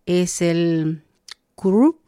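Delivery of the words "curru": curru with a strong trilled rr, higher and louder; curru, trilled r, rising; rolled double r. Shown as a demonstration. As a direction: roll the rr hard in curru. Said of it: "como una doble R la pronunciación".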